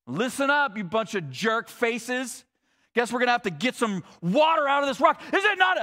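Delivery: a fed-up leader snapping at the crowd; mock angry yelling. Retold Moses yelling at the people, hammed-up rage. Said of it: "he starts yelling at the people".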